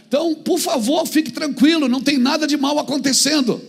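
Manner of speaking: voz de púlpito, alta e mais aguda; pregação enfática. Real, not acted. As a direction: pregue à igreja com força e insistência. Pregação com força. (pregação fervorosa)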